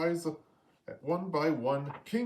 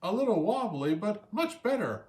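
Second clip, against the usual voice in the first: squawking praise; 9 semitones higher than usual. A narrator's reading. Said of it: "squawked Scuttle".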